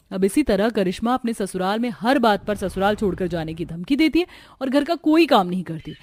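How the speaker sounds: ordinary talk, nothing marked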